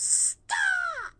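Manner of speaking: imitating a neighbor's sudden high yell of stop; sharp yell. Neighbor yelling at a driver to halt, sudden high shout. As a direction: bark it out high, like a stranger stepping in front of your car. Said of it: "comes around from the back of her car and goes".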